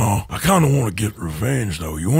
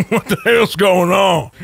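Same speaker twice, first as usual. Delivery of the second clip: bursts into high laughter then shouts through it; real helpless laughter into a shout; laughing hard. Real, not acted. Horse knocks friend off.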